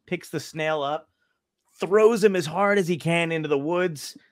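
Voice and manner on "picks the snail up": usual speaking voice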